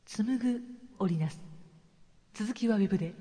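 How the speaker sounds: お題のセリフ. Acted. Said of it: "今日のお題のセリフの続きはウェブでって言ってみた". (お題のセリフ読み)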